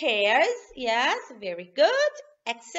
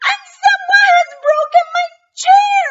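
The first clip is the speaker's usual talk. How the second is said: much higher, louder little bear voice; Baby Bear; 14 dB louder, faster than usual. Acted. Baby Bear cries to his parents, high voice. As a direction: squeak it as the tiny bear. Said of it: "Baby Bear says".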